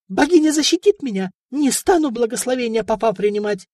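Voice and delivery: high, loud hysterical refusal; shrill protest. Acted. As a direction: keep shrieking defiantly. Princess shrieking refusal continues.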